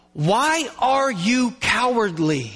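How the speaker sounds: slow, drawn-out, forceful challenge; emphatic, commanding question; stern rebuke. Shown as a demonstration. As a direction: stretch each word into a reproach. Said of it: "He is going".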